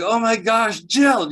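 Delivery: high, loud cry of alarm to his wife; panicked exclamation; alarmed outburst. Retold or imitated. Telling his wife he's in trouble, high alarmed cry. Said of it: "I was like".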